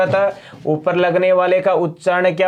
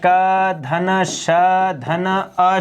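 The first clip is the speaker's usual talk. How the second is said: very slow spelling out; much slower than usual. Shown as a demonstration. Teacher spelling out word parts.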